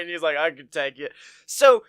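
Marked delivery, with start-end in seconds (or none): 0.4-1.1 mock tough guy. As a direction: lower and softer, mock tough. Boy answering after punch.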